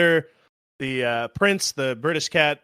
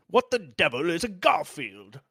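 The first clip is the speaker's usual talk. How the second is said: put-on royal British accent, higher and theatrical; plummy British character impression; Tim Curry; 4 semitones higher than usual. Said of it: "real, like, royal British accent".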